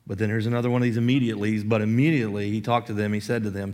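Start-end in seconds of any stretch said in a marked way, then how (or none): none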